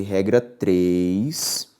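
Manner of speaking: slow down to mark the new rule; slow, deliberate rule heading; slow announcement. Shown as a demonstration. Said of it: "announcing the rule".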